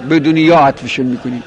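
ordinary delivery

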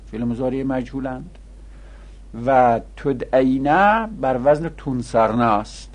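ordinary delivery

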